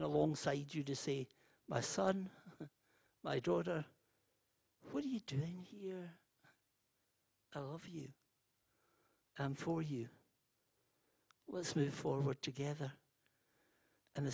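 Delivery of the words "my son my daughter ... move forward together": soft, hushed voice of God; still small voice. Acted. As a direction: gently reassure a son or daughter, very softly. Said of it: "God in a still small voice".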